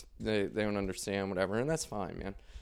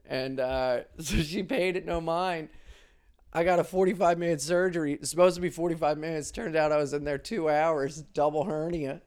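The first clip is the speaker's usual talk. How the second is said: ordinary talk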